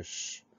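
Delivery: soft, held "ch" hush, no vowel; isolated CH sound. Shown as a demonstration. Teacher sounding out start of choisir, isolated CH sound. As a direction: say "ch" as a pure consonant. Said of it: "Alors, premier son".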